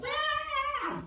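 sudden high squeal of pain; child's pained scream. Retold or imitated. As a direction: squeal like a hurt toddler. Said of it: "she's like".

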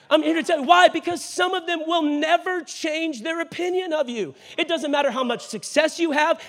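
high, impassioned pulpit delivery; preach. Real, not acted. Preacher warning people who chase approval, forceful exhortation.

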